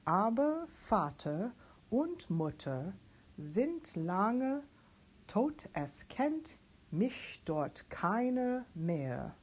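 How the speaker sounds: slow, deliberate spoken German text; teacher modelling German lyric diction; slow German diction reading. Shown as a demonstration. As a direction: read the German line slowly and carefully. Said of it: "Practicing this whole page".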